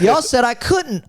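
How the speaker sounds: retold indignation. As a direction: protest it like you were wronged. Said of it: "so I was pissed".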